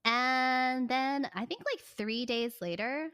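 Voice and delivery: usual speaking voice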